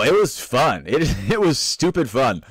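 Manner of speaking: ordinary delivery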